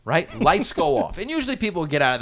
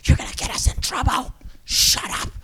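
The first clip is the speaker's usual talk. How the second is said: urgent whispered reprimand, imitated; hushed scolding; 7 semitones higher than usual. Recruit afraid of getting everyone in trouble, whispered scolding. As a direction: scold without using your voice. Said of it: "couldn't really talk at a loud volume".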